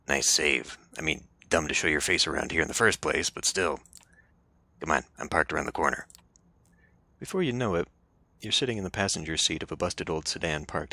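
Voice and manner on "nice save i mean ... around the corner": gravelly voice. Acted. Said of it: "the gravelly voice sure does".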